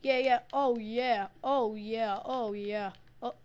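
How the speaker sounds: imitating a gloating player yelling into the mic; mocking gamer taunt. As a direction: gloat and rub it in, shouting. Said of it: "he cries over the mic".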